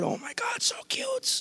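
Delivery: put-on very high, soft baby-talk squeal; squealing softly at a litter of puppies; cooing at puppies. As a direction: melt over the puppies. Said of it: "You ever been there and you go".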